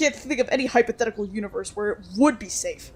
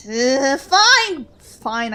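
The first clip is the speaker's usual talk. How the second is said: exasperated; 6 dB louder, much slower than usual. Acted. Exasperated giving in.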